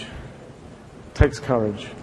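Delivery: slow, soft, almost whispered; hushed repeat. Shown as a demonstration. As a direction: let it sink in, barely breathing it. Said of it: "It takes courage to be a Lunar".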